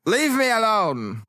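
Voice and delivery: high, drawn-out protest; hammed-up sulky outburst; petulant son. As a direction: push your father away, dragging the words out. Son rejects father in acted quarrel.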